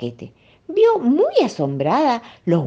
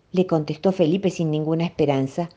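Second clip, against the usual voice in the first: usual speaking voice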